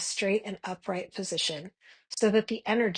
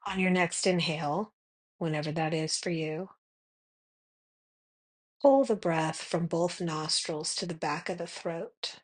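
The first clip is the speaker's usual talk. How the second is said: guided meditation. Acted. Meditation guide leading listeners to notice their breath, calm guided meditation. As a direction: guide them gently into the breath, unhurried.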